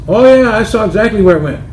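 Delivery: a hint of cheerful overconfidence; confident [name].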